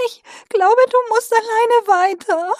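high, slow, resigned small voice; tearful little creature voice. Acted. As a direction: give up tearfully, asking to be left. Injured Minka gives up.

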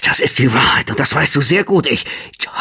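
breathless, half-whispered gasp. A narrator's reading. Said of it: "keuchte Ophelia".

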